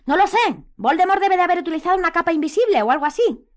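high, loud, exasperated shouting; angry shout. A narrator's reading. Harry answers Hermione's doubts, shouting. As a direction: shout it in frustration. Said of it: "gritó Harry".

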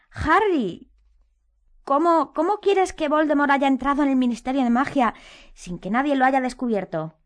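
high, worried, stumbling protest; fearful voice. A narrator's reading. Hermione doubts Harry's story, fear in her voice. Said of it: "una voz que delataba su miedo".

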